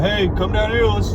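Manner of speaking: high, light brother's voice; imitating his brother. Brother calling him downstairs, mock high voice. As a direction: mimic him calling you down. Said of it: "He'll be like".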